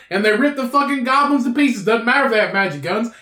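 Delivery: ordinary delivery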